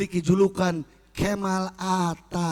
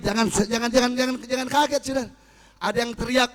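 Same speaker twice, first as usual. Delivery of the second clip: normal talking voice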